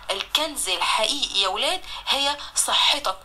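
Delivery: ordinary talk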